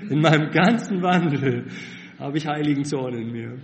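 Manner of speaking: sudden loud burst, tongue in cheek; half-joking loud snap; mock angry outburst. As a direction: blurt it out like a flash of road rage. Ironic rant about road rage.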